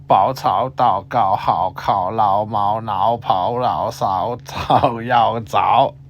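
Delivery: Bao, cao, dao run together quickly in pairs; fast drill. Shown as a demonstration. Teacher reciting ao syllables quickly for learners. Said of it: "as quickly as you can".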